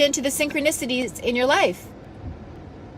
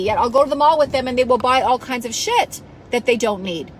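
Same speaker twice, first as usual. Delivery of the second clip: usual speaking voice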